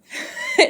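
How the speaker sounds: very high-pitched giggle; short squeal of laughter; amused laugh. Real, not acted. Finds the phrase hilarious.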